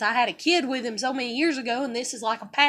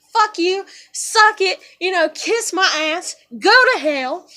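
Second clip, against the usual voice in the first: louder, higher string of insults; angry telling-off; 4 semitones higher, 6 dB louder than usual. Retold or imitated. Telling off everyone who says no, angrily. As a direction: spit the insults out defiantly.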